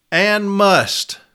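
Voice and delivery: "must" high and drawn out; stress on must. Shown as a demonstration. Reading policy to reps, stressing "must" high and long. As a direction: lift "must" up and hold it, then stop. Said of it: "anytime you see must".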